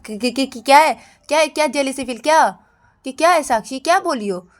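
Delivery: acted taken-aback protest; startled indignation. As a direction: stammer into an affronted demand. Ananya reacts to jealousy accusation.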